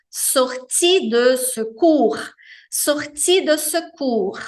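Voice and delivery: sortie de secours devagar, sílaba por sílaba; repetição lenta. Shown as a demonstration. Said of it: "Saída de emergência". (sortie de secours lento)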